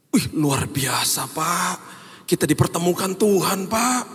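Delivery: high, choked, tearful wonder; tearful amazement. Retold or imitated. Driver recognizing the preacher, crying with amazement. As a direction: gush through tears of awe. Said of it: "Dia, dia nangis".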